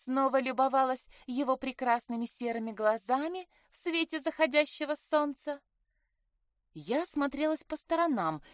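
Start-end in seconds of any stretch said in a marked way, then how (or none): 0.0-5.6 high, mock-romantic sisterly needling; teasing. Sister needling her about her two-legged man, teasing. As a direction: tease her with a lifted, lilting pitch.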